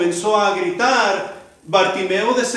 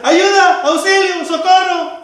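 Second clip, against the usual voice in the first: desperate shouting; 9 semitones higher, 6 dB louder than usual. Acted. Preacher acting out someone crying for help, loud shouting. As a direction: shout each cry high and loud. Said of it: "Solamente comenzamos a gritar".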